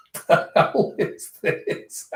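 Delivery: incredulous words lost in laughter; slow, breathless laughing disbelief; real laughing. Real, not acted. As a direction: crack up while talking. Laughing reaction to the page.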